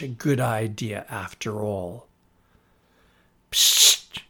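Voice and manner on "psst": sharp whispered psst; unvoiced psst. A narrator's reading. Small monster calling Tina from beside the sofa, whispered psst. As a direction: hiss for attention. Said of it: "said a voice".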